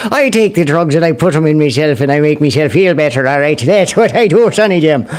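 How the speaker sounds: hammed-up mock Irish brogue. Host jokingly turns Irish while explaining drug use, mock Irish accent. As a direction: play it as a bad, jokey Irish accent. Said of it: "I turned Irish for a second there".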